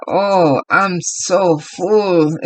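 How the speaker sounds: slow, drawn-out mock groan of a full man; imitating stuffed guest. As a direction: drag the words like you ate too much. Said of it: "he was like".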